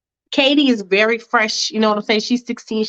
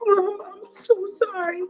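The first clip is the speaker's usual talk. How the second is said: 1 tearful apology; 9 semitones higher, 6 dB quieter than usual. Acted. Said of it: "And Mia's like".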